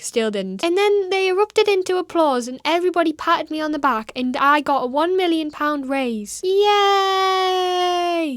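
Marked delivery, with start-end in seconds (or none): 0.6-6.4 mock storybook ending. Making fun of a far-fetched job story, mock storybook ending. Sarcastic made-up happy ending. 6.4-8.3 high, long drawn-out fake cheer; mock cheer. Cheering a made-up happy ending, mock cheer.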